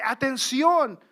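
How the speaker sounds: Atención called out loud, like a warning sign; shouted warning; strong warning word. Acted. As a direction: bark Atención as an alarm. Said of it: "como una palabra fuerte".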